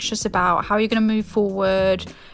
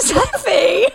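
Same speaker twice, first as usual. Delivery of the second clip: laughing exclamation; 13 semitones higher, 6 dB louder than usual. Real, not acted. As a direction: laugh out her name, much higher and louder. Shocked laugh at friend's confession.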